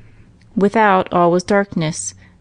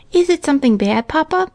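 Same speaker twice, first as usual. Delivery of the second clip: put-on high little-girl question; Minnie asking; 9 semitones higher than usual. A narrator's reading. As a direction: ask papa in a small, high child's voice. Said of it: "asked Minnie".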